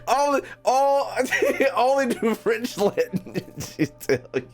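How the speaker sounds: high-pitched rant broken by laughter; laughing rant. Real, not acted. Streamer ranting to chat about school languages while laughing.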